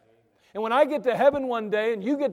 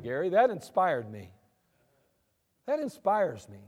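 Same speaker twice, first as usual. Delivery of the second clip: ordinary delivery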